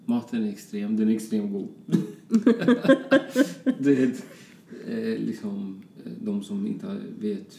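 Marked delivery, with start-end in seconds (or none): 1.7-3.7 a loud, high, delighted laugh; burst of high laughter. Real, not acted. Laughing at how good the food is, high delighted laugh. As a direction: laugh out loud at your own words.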